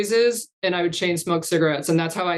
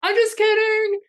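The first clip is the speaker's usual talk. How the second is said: very high, sing-out call to people far off; long, high-pitched playful holler; 3 dB louder than usual. Retold or imitated. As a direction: imitate a prankster calling after departing customers. Calling after people almost out of earshot.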